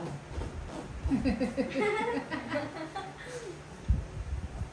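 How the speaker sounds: hearty high laugh; long laugh. Real, not acted. Laughing at own shout-out.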